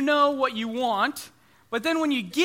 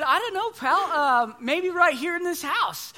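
playful dad; 7 semitones higher, 3 dB louder than usual. Retold or imitated. Dad answering son.

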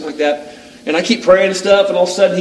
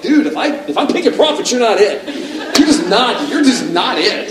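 higher, louder laughing dismissal; laughing put-down; 6 semitones higher, 3 dB louder than usual. Retold or imitated. The preacher rejecting the prophet, laughing and louder. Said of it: "I'm like".

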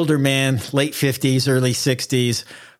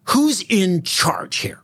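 slow, higher, demanding who leads; official demanding; 6 semitones higher, much slower than usual. Retold or imitated. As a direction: lean on it slowly like a suspicious inspector. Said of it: "first he asks".

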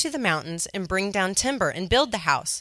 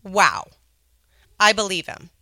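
excited; 7 dB louder than usual. Real, not acted. Thrilled by the promise.

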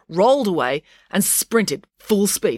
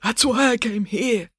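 higher, strained character voice; wounded professor; 7 semitones higher than usual. Acted. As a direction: explain urgently through weakness.

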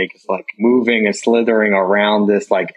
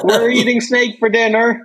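mock kid voice; 12 semitones higher than usual. Mocking kids' voices.

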